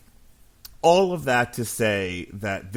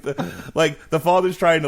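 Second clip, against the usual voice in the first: normal talking voice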